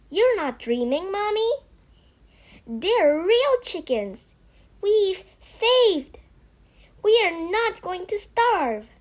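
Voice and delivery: bright eager pleading; excited child. Acted. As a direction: convince her it's real. Child convincing weak mother.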